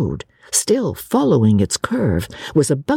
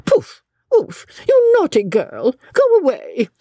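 high, huffy scolding; cross scolding; 12 semitones higher than usual. A narrator's reading. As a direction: shoo a naughty girl away. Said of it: "she said rather crossly".

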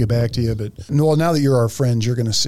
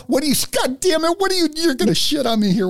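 high-pitched mock outrage, sputtering; hammed-up disbelief, voice shooting way up; mock exasperation. Host hams up outrage at guest's drink order, high-pitched mock exasperation.

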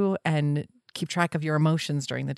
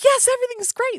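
much higher, gushing yes; eager agreement; 4 dB louder, faster than usual. Retold or imitated. Caught up in the friend's energy, high gushing agreement. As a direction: mirror her excitement right back. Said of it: "And I'm like".

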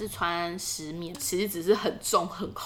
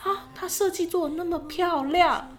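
starry-eyed admiration; 8 semitones higher, 4 dB louder than usual. Retold or imitated. Retelling past hopeful thought.

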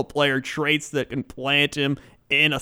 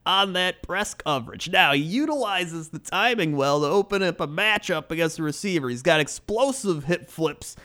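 draft analyst read; 6 semitones higher than usual. Acted. Host reading a draft profile to his cohost, analyst voice. Reads scouting report aloud.

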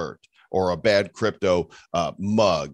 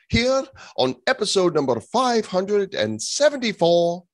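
town crier; 9 semitones higher than usual. Pretend. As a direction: announce it like a medieval town crier. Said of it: "talking like I am the town herald".

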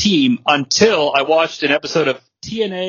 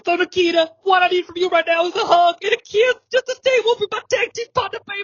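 Dusty Rhodes promo voice; faster than usual. Pretend. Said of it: "he's like".

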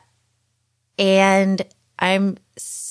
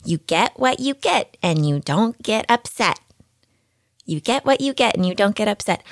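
lightly voiced teacher reciting a rule, higher; mimicked teacher; 4 semitones higher than usual. Teacher telling her daughter the rule, mildly singsong. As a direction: hand down a pat classroom saying, pitched up. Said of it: "a teacher says to my daughter".

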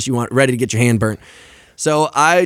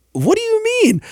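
acted disbelief. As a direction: squeal it in mock confusion. Retells his shock at her reply.

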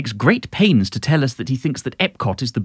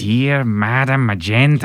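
mock Stimpy voice. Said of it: "Why didn't you do a Stimpy accent?".

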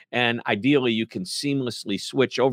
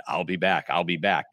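Arnold impression; 6 semitones lower than usual. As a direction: lower German accent, playful. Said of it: "I started having fun in my head".

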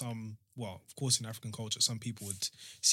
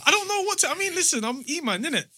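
laughing; 4 dB louder than usual. Real, not acted. Flustered laughing self-intro.

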